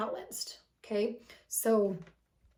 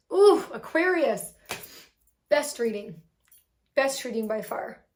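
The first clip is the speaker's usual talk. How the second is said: excited; 8 dB louder than usual. Real, not acted. Reader thrilled by the best reading of Aquarius. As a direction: gush over the sign.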